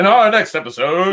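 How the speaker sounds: big TV announcer teaser. Pretend. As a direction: hype it like a show trailer. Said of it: "You sound like an announcer for".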